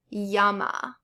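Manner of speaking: "llama" said as "ya-ma"; double L as Y. Shown as a demonstration. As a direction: start "llama" with an English Y. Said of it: "pronounced like the English Y".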